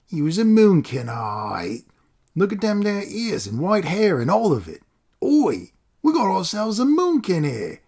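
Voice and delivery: high, coarse Cockney taunt; loud mocking street ruffian voice; rough street thug. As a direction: sneer at the boy like a street tough. Rough stranger taunting a boy.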